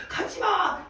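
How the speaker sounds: a hammed-up high wail of don't go; hammed-up shout. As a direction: play the clingy ex, howling after her. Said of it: "이렇게 하는 장면과".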